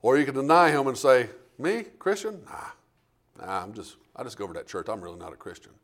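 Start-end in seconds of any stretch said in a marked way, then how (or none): 1.6-5.7 softer, lower, mumbled brush-off; sheepish denial. Pretend. A believer quietly denying his faith to a neighbour, sheepish mumble. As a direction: play it down like you're ashamed.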